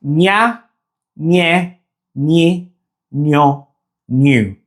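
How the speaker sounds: ña, ñe, ñi, ño, ñu, each a separate single syllable; ñ with five vowels. Shown as a demonstration. Teacher pairing the ñ with five vowels, one syllable each. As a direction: chant out ña to ñu one by one with pauses. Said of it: "the ñ sounds like".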